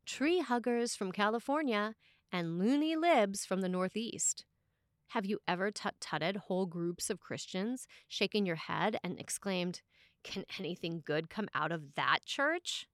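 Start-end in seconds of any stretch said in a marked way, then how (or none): none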